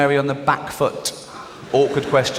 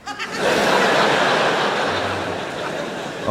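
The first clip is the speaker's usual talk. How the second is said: high breathy giggle. Real, not acted. Laughing at Steve haggling.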